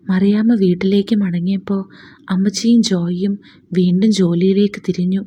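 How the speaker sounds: plain reading voice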